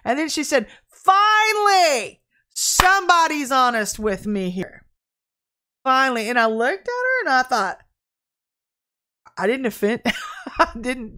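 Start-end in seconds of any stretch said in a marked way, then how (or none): 0.9-6.3 loud, high, drawn-out retold outburst; hammed-up shout of relief; roaring exclamation. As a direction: imitate her roaring it out, stretching the words. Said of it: "she started just roaring, laughing".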